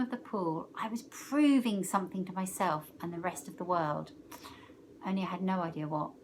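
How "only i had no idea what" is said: usual speaking voice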